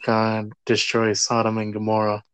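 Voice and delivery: normal talking voice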